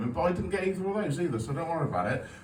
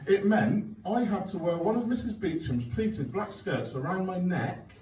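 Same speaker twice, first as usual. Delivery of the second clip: usual speaking voice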